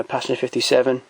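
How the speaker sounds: ordinary talk, nothing marked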